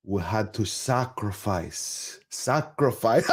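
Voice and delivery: slow, drawn-out mock gravity; mock solemn actor. Host imitating the actor on his hardship, slow mock solemnity. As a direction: imitate a self-important actor, weighing each word.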